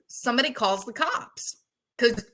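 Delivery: normal talking voice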